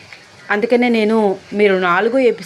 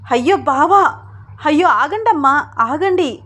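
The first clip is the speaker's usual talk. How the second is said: high, urgent cry to stop; alarmed plea; 7 semitones higher, 3 dB louder than usual. A narrator's reading. Sita tries to stop mother hitting Ram, alarmed plea. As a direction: plead in alarm, pitch raised. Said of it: "ఆపడానికి ప్రయత్నిస్తూ".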